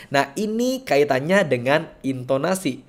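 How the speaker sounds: normal talking voice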